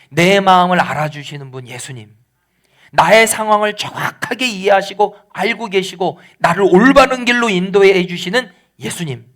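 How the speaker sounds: usual speaking voice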